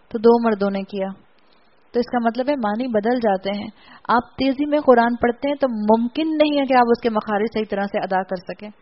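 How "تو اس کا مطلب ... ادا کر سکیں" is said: usual speaking voice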